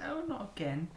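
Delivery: ordinary delivery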